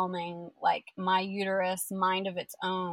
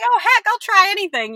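much higher and louder eager yelp; mock eager; 13 semitones higher, 12 dB louder than usual. Telling the listener how she agreed, high and loud. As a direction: throw yourself at the idea, squeaky and loud.

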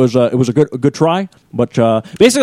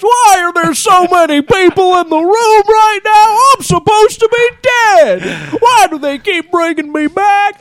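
much higher, slow Don Knotts impression; slower than usual. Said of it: "then you're just like Don Knotts".

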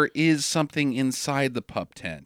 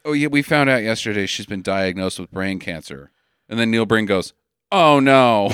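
too dramatic; 8 semitones lower than usual. Retold or imitated. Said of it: "you got that way too dramatic".

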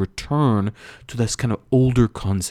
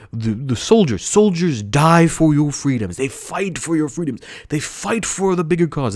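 mock patriotic rhetoric; 7 semitones higher than usual. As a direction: lightly ham up the "they fight for your freedoms" line. Mimicking American patriotic rhetoric.

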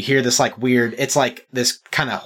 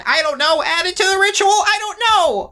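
very high, exasperated squeak; a squeaky little undead complaining; squeaky flustered Limden. Acted. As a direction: panic upward into a squeaky protest.

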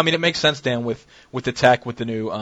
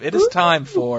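high, drawn-out announcer call; segment announcement; 3 dB louder, slower than usual. Acted. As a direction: stretch out a big show-segment intro.

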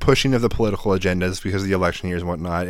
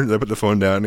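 ordinary talk

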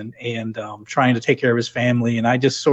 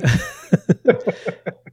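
laugh; 7 semitones higher, 3 dB louder than usual. Real, not acted. Laughs at own story.

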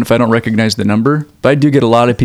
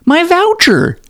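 high, sugary fake gratitude; mock grateful; slower than usual. Mocking a telemarketer call with fake delight. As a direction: gush sarcastically over the voucher. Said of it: "And you go".

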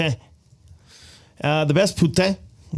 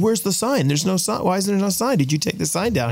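flustered questioning; 7 semitones higher than usual. Retold or imitated. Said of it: "he said".

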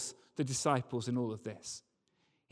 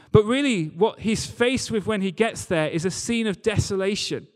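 preaching, higher and louder, building; 5 semitones higher, 4 dB louder than usual. Acted. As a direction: lift the congregation with rising fervour. Sermon builds to climax.

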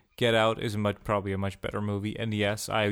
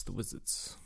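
whisper; 3 semitones lower, 12 dB quieter, slower than usual. Pretend. Hushed mystical aside.

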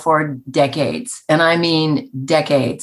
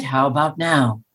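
low, even assistant voice; Siri; 5 semitones lower than usual. Retold or imitated. Guest proving herself to a doubting boy, assistant voice. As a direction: drop into a composed digital-assistant register. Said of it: "You don't sound like Siri".

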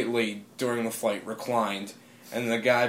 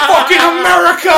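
high, very loud triumphant holler; patriotic yell; 13 dB louder than usual. Retold or imitated. Celebrating with a stranger on the plane, patriotic yell. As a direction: belt it out in mock-patriotic glee.

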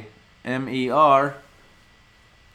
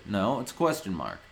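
normal talking voice

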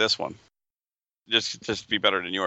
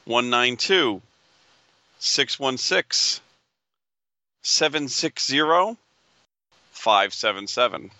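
raffle caller; 3 semitones higher, slower than usual. Acted. Reads item number slowly.

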